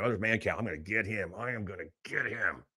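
low, very soft menace; quiet, stalking voice of someone out to get him; gunning for him. Retold or imitated. Host imitates a woman coming for him, hushed menace. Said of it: "this woman came in gunning for me".

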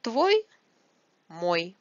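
usual speaking voice

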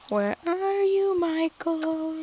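high, slow eerie ghost voice; haunt him with a drawn-out call; spooky calling. Pretend. Calling out to hidden friend in cave.

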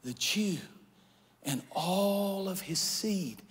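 soft, hushed confession to the king; a queen's soft, trembling plea; Esther's hushed plea. Acted. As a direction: lay it bare quietly, almost in secret. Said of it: "She said".

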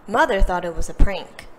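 plain reading voice

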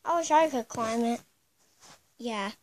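normal talking voice

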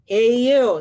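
loud, drawn-out challenging call; grouchy ladybug. Acted. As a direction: call out like a grouchy bug picking a fight. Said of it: "said the grouchy ladybug".

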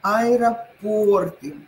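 аэропорты drawn out slowly, stress on ПО; slow correct stress. Shown as a demonstration. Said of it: "правильно сказать". (аэропОрты, очень медленно)

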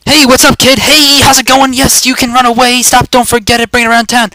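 high, loud hyped-up taunting; taunting call. Pretend. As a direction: shout after a fleeing opponent, high and loud. Calls after player while attacking.

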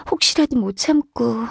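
ordinary talk, nothing marked

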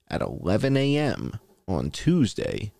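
ordinary delivery

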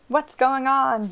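loud, slow call. A narrator's reading. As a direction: call it out across the deck. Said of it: "Bob called".

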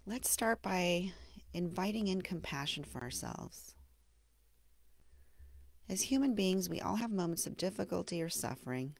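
gentle meditation guide with long, settling pauses; guided meditation. Acted. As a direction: ease the listener into self-compassion, unhurried and hushed at the phrase ends. Leads a mindfulness meditation.

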